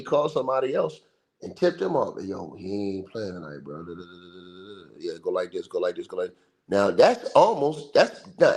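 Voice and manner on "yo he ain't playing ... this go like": low hushed tip-off, trailing into mumbled da da; whisper-soft conspiratorial tip; secret phone tip. Retold or imitated. Imagined Jones tipping someone off.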